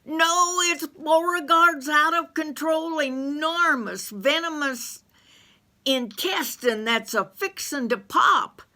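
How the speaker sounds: high, loud and choking; high, panicked, gagging; gagging Carl. Acted. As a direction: shriek it while retching. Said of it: "Carl gagged back".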